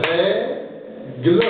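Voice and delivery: "Règle" in two separate beats, rè, gle; "Règle" stretched with a gap in the middle; split into syllables. Shown as a demonstration. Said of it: "tapez les mains".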